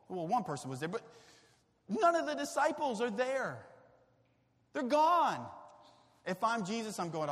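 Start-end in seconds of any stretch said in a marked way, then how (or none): none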